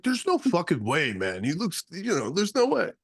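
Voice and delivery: lightly voiced disbelieving friend. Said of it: "He was like".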